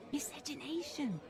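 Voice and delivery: hushed, as if the word is shameful; whispered. Acted. Says the taboo word under breath.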